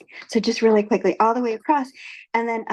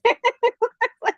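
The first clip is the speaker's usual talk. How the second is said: much higher put-on voice for a friend, slowing at the end; friend's voice. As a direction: play the friend gently correcting her, pitched way up. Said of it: "He says".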